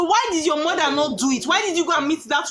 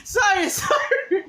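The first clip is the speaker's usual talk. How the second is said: slow, much higher sorry broken by giggles; apologizing while laughing; 10 semitones higher, much slower than usual. Real, not acted. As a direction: say sorry but can't stop laughing. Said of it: "Don't laugh again".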